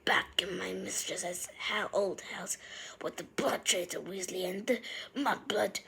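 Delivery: soft hissing whisper under his breath; whispered muttering. A narrator's reading. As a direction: mutter insults so only he hears. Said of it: "muttering to his niece".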